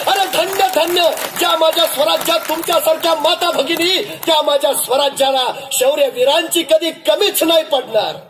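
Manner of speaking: rousing king. Acted. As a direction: leap up and hail them proudly. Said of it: "ताडकन उभा राहिले राज". (उंच, उत्स्फूर्त, अभिमानी राजाचा आवाज)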